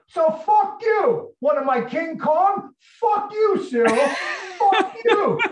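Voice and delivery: furious high-pitched, full-volume yell; acting out an enraged, cursing scream at the top of the voice. Tony screaming at Cyril as he leaves, furious high yell. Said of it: "he's screaming at him".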